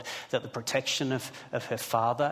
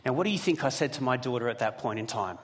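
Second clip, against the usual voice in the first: usual speaking voice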